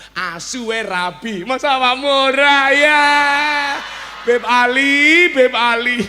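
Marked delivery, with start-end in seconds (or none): 1.5-6.0 drawn-out loud call across the room. Acted. Calling Habib Ali from the crowd, loud drawn-out call. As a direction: call his name loudly.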